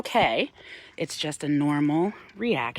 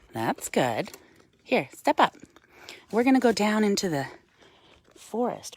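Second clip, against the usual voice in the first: ordinary talk, nothing marked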